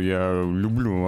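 normal talking voice

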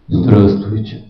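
very low booming greeting; extremely deep bass voice. Retold or imitated. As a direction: drop the voice deep into the chest. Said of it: "Они вот так говорят". (очень низкий басовитый голос)

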